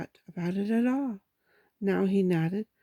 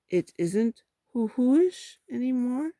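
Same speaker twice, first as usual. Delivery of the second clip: slow, higher hopeful question; 5 semitones higher, 4 dB louder than usual. A narrator's reading. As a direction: ask it slowly, hoping for a yes.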